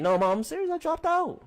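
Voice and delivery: insisting loudly. Retold or imitated. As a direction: push it out to convince mom he is not joking. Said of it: "I was like".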